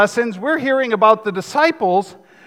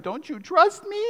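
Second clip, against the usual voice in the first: higher, soft whiny pout; pouty plea; 7 semitones higher, 5 dB quieter than usual. Retold or imitated. Husband pleading with his wife, pouty and whiny. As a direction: put on the pouty lip and plead. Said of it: "big puppy dog eyes and the pouty lip".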